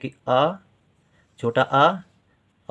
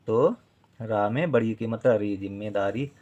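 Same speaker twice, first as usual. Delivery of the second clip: usual speaking voice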